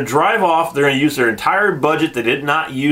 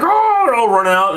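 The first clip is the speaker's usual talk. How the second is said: high, loud mock yelp; a sudden squeaky startled oh; mock surprise; 8 semitones higher, 3 dB louder than usual. Reviewer mocks soldiers pushing people off train, high yelp. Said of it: "And they're like".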